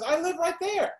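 high mock woman protesting; high mock lady. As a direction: mimic the neighbour high and huffy. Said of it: "She goes".

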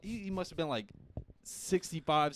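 ordinary talk, nothing marked

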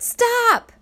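sudden high shouted stop; sharp alarmed scream to stop. A narrator's reading. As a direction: yell it out to halt the car. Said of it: "he suddenly screamed out".

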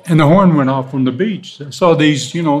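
ordinary talk, nothing marked

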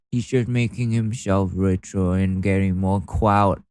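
mocking silly voice. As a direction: taunt them in a drawling put-on baby-talk voice. Mocking angry posters in silly voice.

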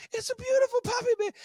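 very high, loud mock wife squeal; gushing wife. Imitating the wife squealing to her husband, very high and loud.

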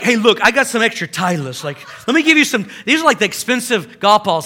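loud, eager offer; bright generous pushy tone; voicing the golfer. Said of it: "he's like".

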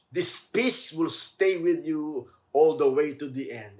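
fervent preaching. Acted. Preacher urging the congregation, impassioned. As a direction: preach the promise of peace with fire.

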